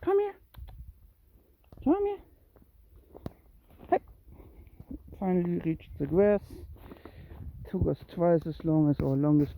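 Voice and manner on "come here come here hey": much higher, short coaxing calls; high-pitched pet call. Real, not acted. Owner calling the cat to follow, high coaxing call.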